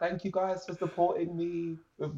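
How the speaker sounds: high, slow, overly serious thanks; mock somber. Performer thanking fans for mental health support, mock somber. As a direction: put on a slow, high, solemn thank-you. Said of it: "So somber, so serious".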